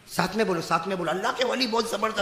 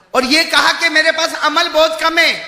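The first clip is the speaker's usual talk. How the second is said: loud, high, measured shouting; full-force preaching; 10 semitones higher, 12 dB louder than usual. Retold or imitated. Dying saint lists fears of the journey, full-force preaching. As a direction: hurl each warning out separately.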